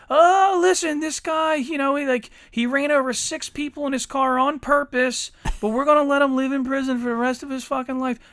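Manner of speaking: high, loud sarcastic imitation of a lenient official; mock outrage voice. Mocking a lenient judge to a friend, high and loud. Mocking soft justice system.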